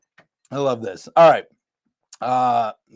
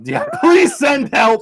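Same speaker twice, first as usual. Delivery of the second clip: laughing mock plea; 9 semitones higher, 4 dB louder, slower than usual. Real, not acted. Joking plea.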